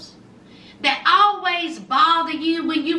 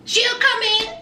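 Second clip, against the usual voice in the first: ordinary delivery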